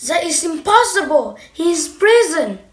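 alarmed. Acted. Shifu shocked at the news.

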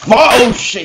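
high, loud yelp of alarm; real jolt of panic; startled. Real, not acted. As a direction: blurt it out in sudden fright. Spots the enemy suddenly.

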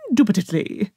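INDUBITABLY with a lilting, slight British accent. Shown as a demonstration. Streamer showing how the word must be said, slightly British. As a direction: hit the first syllable of INDUBITABLY, lightly British. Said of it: "You have to put, like, a slight British accent".

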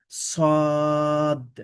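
সদ with a thick, slightly whistled hiss on the স; emphatic Arabic sad letter. Shown as a demonstration. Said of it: "একটু শীষ হবে".

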